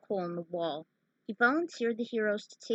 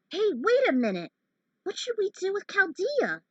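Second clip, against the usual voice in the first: higher and louder, calling out to stop them; alarmed outcry; 12 semitones higher, 6 dB louder than usual. Acted. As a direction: shout after them, suddenly alarmed. Character calls out in alarm.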